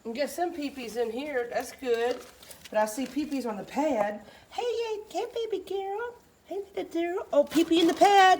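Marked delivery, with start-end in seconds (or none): 4.5-8.4 high-pitched, excited cooing. Real, not acted. Baby-talking to a puppy.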